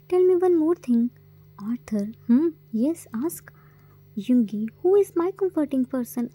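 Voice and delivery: plain reading voice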